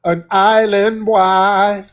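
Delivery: slow mocking jab at the caller; taunting. Real, not acted. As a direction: stretch it out to needle him. Taunting the caller on a live stream.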